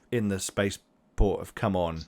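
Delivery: ordinary talk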